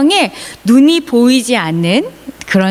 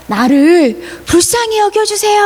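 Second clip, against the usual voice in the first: high, imploring acted cry; pleading call; 10 semitones higher than usual. Beggar imploring Jesus for mercy, high call. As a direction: plead upward, voice pitched high. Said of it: "큰 목소리로 예수님을 불렀어요".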